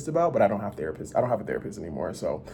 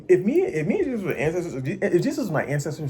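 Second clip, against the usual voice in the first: excited; 4 semitones higher than usual. Real, not acted. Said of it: "sorry, I'm getting a little, like, excited".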